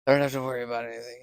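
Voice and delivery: fast, loud, tumbling out; rushed relief. Retold or imitated. Telling himself not to worry, in a fast loud rush. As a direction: blurt out the relief. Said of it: "I'm like".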